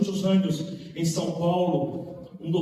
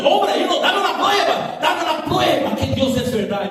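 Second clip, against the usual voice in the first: mock demand; 13 semitones higher, 6 dB louder than usual. Retelling the atheist's demand.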